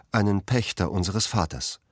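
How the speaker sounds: usual speaking voice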